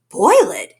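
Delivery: gasped. Acted. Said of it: "gasped Horton".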